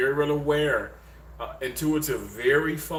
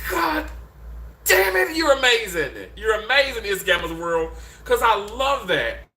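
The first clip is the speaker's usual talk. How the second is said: high, impassioned gushing; real swell of feeling, pitched way up; emotional praise; 5 semitones higher, 3 dB louder than usual. Real, not acted. Host praising a viewer's unshaken faith, high emotional outburst. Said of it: "that makes me emotional though".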